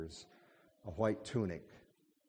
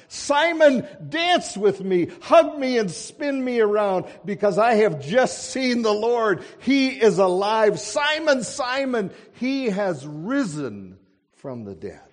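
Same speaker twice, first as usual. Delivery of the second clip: a much higher, much louder joyful shout; ecstatic shout; 15 dB louder than usual. A narrator's reading. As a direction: call out to Simon bursting with joy. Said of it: "she ran to Simon Peter crying".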